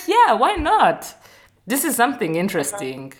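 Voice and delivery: high, quick, eager voice; excited. Retold or imitated. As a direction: sound keen and delighted by the idea. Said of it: "But I was like".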